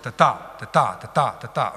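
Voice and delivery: "ta ta" as short then long, second one stressed; iambic beat. Shown as a demonstration. Teacher reminds students what an iamb is, beaten short-long rhythm. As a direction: tap out light-HEAVY pairs. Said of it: "un court, un long".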